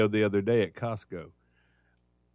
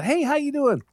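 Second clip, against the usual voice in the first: cheery greeting; 5 dB louder than usual. Retold or imitated. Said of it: "When you go in and I say".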